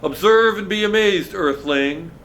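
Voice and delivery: high, loud mock-grand proclamation; boy playing a lofty alien to a friend, booming; grand alien greeting. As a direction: announce it like a proud space commander. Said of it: "and he goes".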